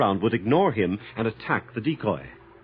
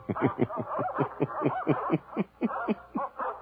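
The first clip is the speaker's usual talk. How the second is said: high, faint broken laugh; crazed laughter; 12 semitones higher, 6 dB quieter than usual. Acted. Staring at his own hand, a high soft crazed laugh. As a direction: let a cracked laugh slip out.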